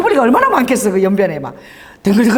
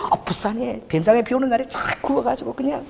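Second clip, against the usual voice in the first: usual speaking voice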